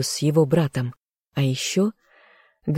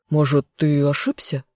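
stunned. A narrator's reading. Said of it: "потрясённо уточнил Эдгар".

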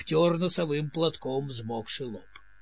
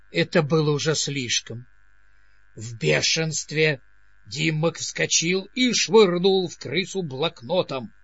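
ordinary delivery, nothing marked

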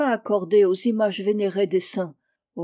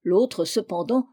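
ordinary delivery, nothing marked

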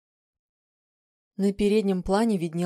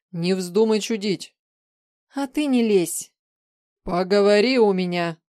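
stern warning; 3 semitones higher, 7 dB louder than usual. A narrator's reading. Sharp warning exchange before leaving.

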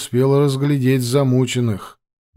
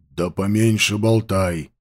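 gruff order; 4 semitones lower than usual. Acted. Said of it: "рыкнул тот".

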